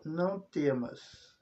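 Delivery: slow emphatic quote. Shown as a demonstration. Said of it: "Outra frase que é interessante".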